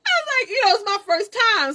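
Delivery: high, loud, helpless giggly talk; laughing while talking. Real, not acted. Mom recalling her first labor, laughing hard. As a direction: squeal it out through real laughter.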